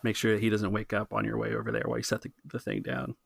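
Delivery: ordinary delivery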